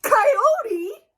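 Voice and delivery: very high, loud shocked cry; alarmed shout. Acted. Sheepdog suddenly spots the coyote, shocked high shout. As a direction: shriek the word in alarm.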